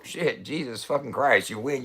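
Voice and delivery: ordinary talk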